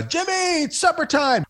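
put-on calling a kid in for supper; mother calling out. Mother calling her son in for supper from the door, high sing-song call. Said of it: "if a mother yells out the door in the evening".

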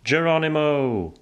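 high, drawn-out battle cry; long, high, gleeful holler; jump cry. A narrator's reading. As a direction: yell it leaping from a plane, stretching the last vowel. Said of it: "vars första ord när vi hoppade".

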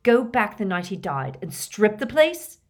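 angry accusing snarl; seething, rising in volume. A narrator's reading. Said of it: "snarled Harry".

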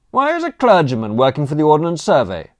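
loud, high, fast challenge; suspicious shout. A narrator's reading. Don querying a clergyman surveyor, shouting suspiciously. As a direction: call after them demanding an explanation.

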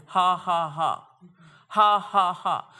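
loud forced ha ha ha; put-on laugh. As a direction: push out a deliberate laugh at the enemy. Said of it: "I was putting on a laugh".

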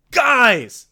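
loud, high call. Acted. Rachel calling the flock from ahead, loud. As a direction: shout to get their attention.

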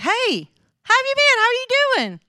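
very high, sing-out social hello; gushing fake greeting. Greeting the women at the church ladies' luncheon, gushing. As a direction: greet them with over-bright, put-on delight.

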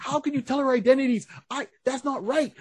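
high, whiny mock outrage; hammed-up indignant protest; Robin protesting. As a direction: complain in a high sidekick voice.

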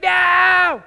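high, loud, squealing no; wife crying out no; shrill wife shout. Retold or imitated. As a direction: shriek it as the upset wife. Wife's reply in acted quarrel.

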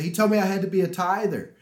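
high, loud retold excitement; excited. Husband re-enacts sharing God's word with wife, excited. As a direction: proudly announce it, pitch way up. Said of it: "I came home and I'm excited".

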